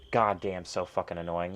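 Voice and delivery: normal talking voice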